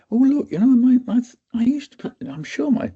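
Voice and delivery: high-pitched mock worrier; mock fussing. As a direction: mimic yourself nagging people about symptoms. Mocking himself pestering people.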